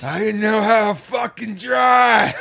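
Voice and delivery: high slow raspy yell. Pretend. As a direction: shout it like a rasping rock star. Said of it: "Doing my raspy [name] voice".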